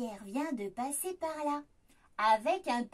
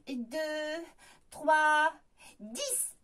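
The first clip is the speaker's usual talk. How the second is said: plain reading voice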